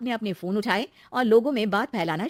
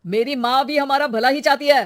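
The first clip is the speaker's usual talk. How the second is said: loud, raised-pitch retort; angry shouting; 5 semitones higher, 9 dB louder than usual. Acted. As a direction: yell back at your wife. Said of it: "चिल्ला क्यों रहे हो". (गुस्से में चिल्लाते हुए)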